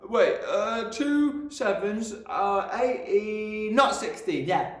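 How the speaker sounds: put-on high, slow, stumbling child; flustered boy. A narrator's reading. Boy scrambling to fix his sum, flustered. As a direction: fumble for the answer nervously.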